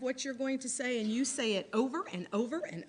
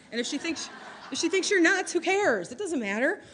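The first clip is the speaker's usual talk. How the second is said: louder, higher who cares; emphatic; 7 semitones higher, 6 dB louder than usual. Real, not acted. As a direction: shrug it off loudly. Defiant pep talk.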